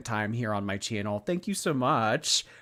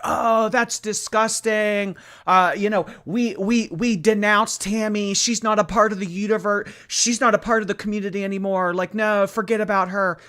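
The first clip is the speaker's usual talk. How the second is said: high, loud mock outrage, disgusted; disgusted denouncing crowd; 9 semitones higher, 5 dB louder than usual. Commentator voices the community denouncing Tammy, high mock outrage. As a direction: play an indignant community disowning someone. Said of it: "It could have been like".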